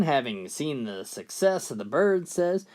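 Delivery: normal talking voice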